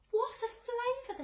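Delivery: very high, loud exclaim; crying out. A narrator's reading. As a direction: shout your delight. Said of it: "the centipede cried".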